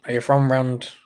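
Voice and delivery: ordinary delivery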